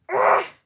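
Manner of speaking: loud squeaky giggle; sudden high laugh; high burst of laughter. Real, not acted. Laughs at costume trouble.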